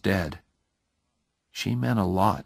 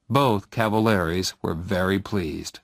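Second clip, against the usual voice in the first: ordinary delivery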